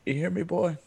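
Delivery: lightly voiced high-pitched drill dad; a hint of mock drill sergeant; drill-instructor dad.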